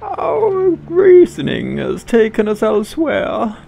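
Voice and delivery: high, stately royal voice; Queen of England voice. Acted. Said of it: "my Queen of England voice".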